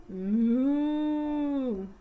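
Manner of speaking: mooing like a cow; playful moo. Shown as a demonstration. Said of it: "What does the cow say?".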